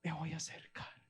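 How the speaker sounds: soft determined murmur; a touch of quiet resolve. Acted. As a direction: say it to yourself, softly.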